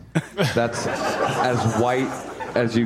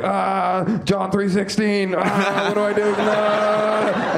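higher, slightly slower panicky gasps; 6 semitones higher, much slower than usual. Pretend. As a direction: gasp it out like a guilty churchgoer.

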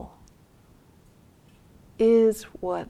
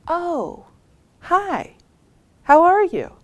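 much higher, friendly storybook hello; caring, hospitable greeting; 10 semitones higher, 8 dB louder than usual. Retold or imitated. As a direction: greet a stranger openly, with pauses between phrases. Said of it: "greeting him with care and hospitality".

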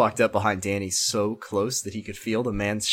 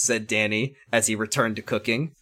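usual speaking voice

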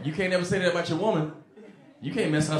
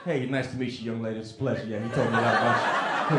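polite low gentleman; 7 semitones lower, faster than usual. Retold or imitated. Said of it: "He was like".

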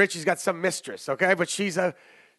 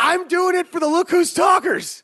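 high, loud hype; excited shout-out; 9 semitones higher, 4 dB louder than usual. Real, not acted. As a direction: pump up the listeners.

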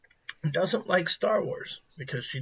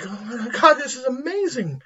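excited; 9 semitones higher, 3 dB louder than usual. Retold or imitated. Wife's excited reaction voiced.

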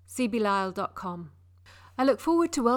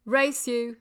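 ordinary delivery, nothing marked

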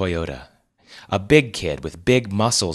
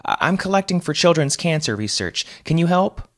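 higher, pleading woman's voice; charity collector; 10 semitones higher than usual. A narrator's reading. Collector pleads with Wes for cancer charity, higher voice. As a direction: ask sweetly for a donation.

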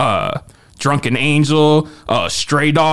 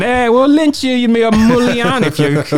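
hammed-up Italian tough-guy accent, higher and louder; 10 semitones higher, 3 dB louder than usual. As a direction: bark it like a cartoon Italian mobster. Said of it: "your Italian imitation".

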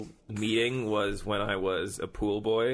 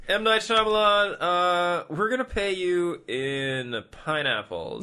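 mock studio exec; 8 semitones higher, 4 dB louder, slower than usual.

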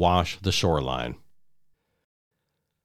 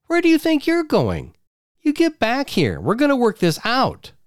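shrill pursuit. Acted. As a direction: chase him down with her voice. Wife chases him.

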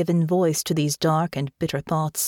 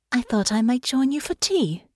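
put-on higher, light young lady voice; young woman voice; 7 semitones higher than usual. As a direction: voice a gentle young noblewoman.

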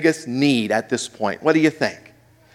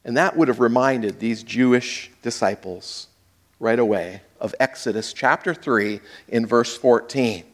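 ordinary delivery

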